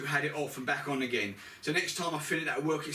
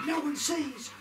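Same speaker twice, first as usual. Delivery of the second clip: excited exclaim; 11 semitones higher, slower than usual. Real, not acted. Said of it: "repeated exclamation".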